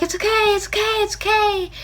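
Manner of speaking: soothing. Retold or imitated. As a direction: comfort a sobbing friend gently. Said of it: "Baz is sitting there and he's like".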